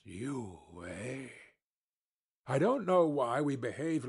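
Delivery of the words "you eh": much lower and far softer, conspiratorial. Acted. Boiler-maker to narrator, a hushed low aside. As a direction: drop to a secretive hush. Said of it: "Then in a low voice".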